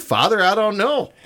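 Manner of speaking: ordinary talk, nothing marked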